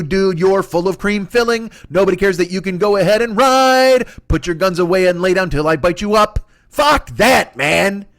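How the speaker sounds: normal talking voice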